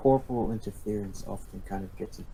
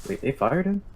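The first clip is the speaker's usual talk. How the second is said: surprised; 7 semitones higher, 7 dB louder than usual. Real, not acted. Surprised at news.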